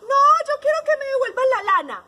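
high, loud, insistent wail; protesting sheep. A narrator's reading. Sheep demanding her wool back, loud protest. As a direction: cry out like an itchy storybook sheep. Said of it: "la oveja también dijo".

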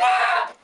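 breathy loud battle yell; fierce shout. Acted. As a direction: yell out as you lunge. Attacking, yelling.

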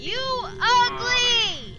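loud, slow, high mocking yell; mocking shout. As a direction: drag it out to insult her. Mocking the ghost.

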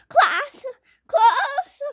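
high, loud crying call of class; panicked wail. Acted. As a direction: wail in panic about being late. Panicking about being late.

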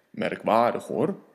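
low, deep monster rumble. Acted. Gruffalo sizing up his dinner, low and deep.

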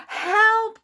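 high, loud cry for help; panicked cry. A narrator's reading. Toad to anyone as the list blows away, panicked cry. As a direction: cry out in storybook alarm. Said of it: "cried Toad".

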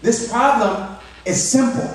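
lightly voiced confident kid; dad quoting his eleven-year-old daughter; child voice. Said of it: "She goes".

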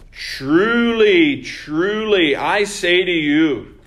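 forceful sermon proclamation; preaching proclamation. Acted. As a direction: declare it to the congregation. Proclaims Jesus's solemn words.

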